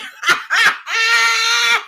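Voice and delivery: howling laugh, near tears; high shrieking laugh. Real, not acted. Laughing hard while telling viewers about the live. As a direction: cackle high and loud, can't stop.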